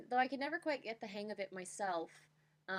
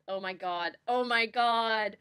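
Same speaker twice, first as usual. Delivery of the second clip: loud, slow, cringing oh my god; embarrassed shriek; 2 semitones higher, 10 dB louder, slower than usual. Real, not acted. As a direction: squeal in embarrassment. Cringing at her old poem.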